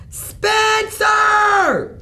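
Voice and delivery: high, loud scream of his name; mother screaming at the messy pile. Acted. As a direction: yell the name across the house. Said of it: "she screamed".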